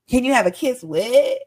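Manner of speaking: normal talking voice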